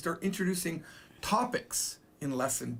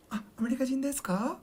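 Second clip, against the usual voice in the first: curious asker voice; 8 semitones higher, faster than usual. Acted. Acted Japanese asker quote.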